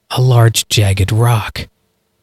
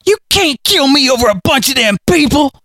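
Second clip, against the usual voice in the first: pleading hillbilly; 12 semitones higher than usual. A narrator's reading. Harley keeps pleading with Galen.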